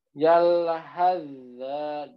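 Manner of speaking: Yalhazza stretched very slowly; tajwid recitation. Shown as a demonstration. Teacher demonstrating the merge, slow recitation. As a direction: draw out Yalhazza with sa merged into zal. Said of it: "huruf sa dengan huruf zal".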